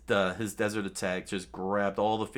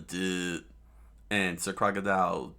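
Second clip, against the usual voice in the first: ordinary delivery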